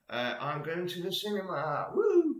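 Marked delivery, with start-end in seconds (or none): 1.9-2.4 high loud whoop of joy; excited whoop. Real, not acted. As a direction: cheer it out, delighted. Excited about cinema trip.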